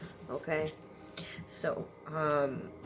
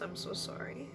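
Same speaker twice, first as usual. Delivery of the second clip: whisper; 7 dB quieter than usual. Real, not acted. Emotional apology, whisper.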